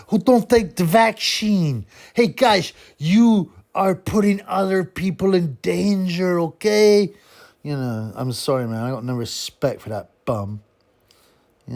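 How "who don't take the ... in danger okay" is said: put-on high, slow guilt-tripping Swedish accent; mock Swedish accent. Boxer shaming people over the vaccine, mock Swedish accent. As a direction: scold them slowly in a slushy, high Swedish accent. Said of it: "trying to make people feel guilty".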